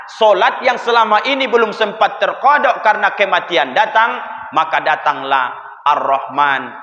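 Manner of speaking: ordinary talk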